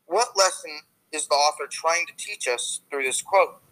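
ordinary talk

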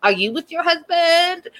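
prissy and superior, imitating Trish's reply to Yara; hoity-toity. A haughty mother-in-law snapping back at Yara, mocking hoity-toity. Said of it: "gets all, like, hoity-toity".

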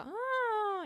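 put-on high, babyish squeal; Teletubby cry. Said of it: "i versi". (verso acuto da Teletubby)

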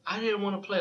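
put-on high, small scared girl's plea; little girl voice. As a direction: plead like a frightened little girl. Young girl pleads with kids.

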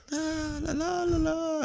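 soft, high wordless la-la; groggy waking noise. Retold or imitated. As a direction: imitate waking up gently, very quietly. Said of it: "Even with my alarm, I'm like".

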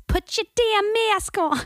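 high, slow, barked shout; barking yell. Retold or imitated. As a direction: bark it out like an angry New Yorker. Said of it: "Why are you barking?".

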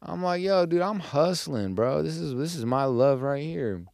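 normal talking voice

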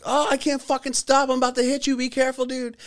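frantic high warning, played for laughs; panicked face voiced. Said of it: "seeing my face like".

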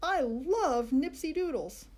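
slow, loud devoted declaration; loud boy. A narrator's reading. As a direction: declare love for the snack. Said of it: "he said real loud".

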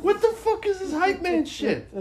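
high-pitched disbelief, laughing; incredulous laughing. Real, not acted. Comedian baffled by the video's hype man, incredulous laughing. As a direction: squeal the question in amazed amusement.

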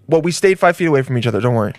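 ordinary talk, nothing marked

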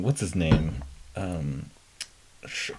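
ordinary delivery

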